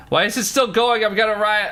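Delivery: exaggerated fed-up whine; mock outrage. Real, not acted. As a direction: complain like you're about to riot. Ad keeps playing, frustration.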